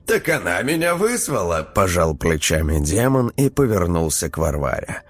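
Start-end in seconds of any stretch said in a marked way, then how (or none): 0.1-1.7 casual, higher demon voice. A narrator's reading. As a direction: shrug it off, demon-like. Demon shrugs.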